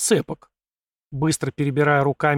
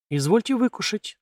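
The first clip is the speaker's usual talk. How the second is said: higher, coaxing languid offer; 7 semitones higher than usual. Acted. Beauty offering the prince a glass of vodka, languid. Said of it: "томно предложила она".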